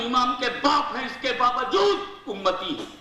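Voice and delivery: ordinary talk, nothing marked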